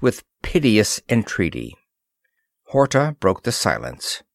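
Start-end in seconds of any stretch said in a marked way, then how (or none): none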